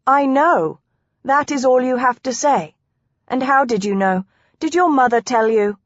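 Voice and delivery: frowning, incredulous wife; indignant frown. Acted. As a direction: challenge him, mildly affronted. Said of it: "frowned at him".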